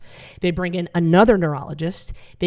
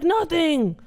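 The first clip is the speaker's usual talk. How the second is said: much higher and louder; high-pitched stoned dad; 11 semitones higher, 5 dB louder than usual. Retold or imitated. Comic voicing stoned dad listing breakfast, high and chirpy. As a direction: chirp it innocently. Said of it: "He's like".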